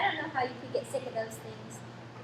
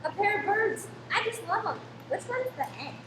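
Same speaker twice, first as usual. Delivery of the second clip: delighted; 7 semitones higher than usual. Acted. Boy delighted by gift birds.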